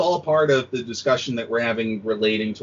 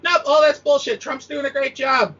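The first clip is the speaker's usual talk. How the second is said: mock Fox pundit; 13 semitones higher than usual. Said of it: "the fun channel where it's like".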